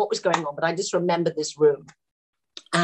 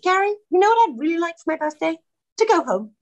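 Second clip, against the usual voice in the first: high, quick, sweetly put appeal; retold pointed request; 10 semitones higher, faster than usual. Tired actress asking director for a birthday gift, high appeal. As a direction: mimic a polite plea to the boss, pitched up. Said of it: "And then she just went".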